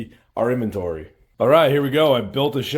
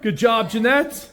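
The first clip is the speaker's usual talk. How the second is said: cheery praise; 8 semitones higher, 5 dB louder than usual. Real, not acted. Praising cleaner while filming.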